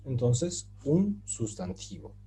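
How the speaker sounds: ordinary delivery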